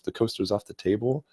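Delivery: ordinary delivery, nothing marked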